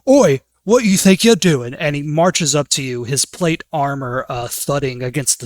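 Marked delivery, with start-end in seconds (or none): 0.1-1.7 gruff guard barking a challenge; guard's challenge. Acted. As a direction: catch him in the act, loud. Guard catches goblin doing magic.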